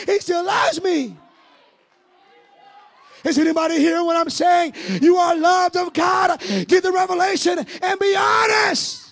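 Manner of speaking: loud, high, building preacher's cry; full-force preaching. Acted. Preacher shouting to the congregation about the woman's joy.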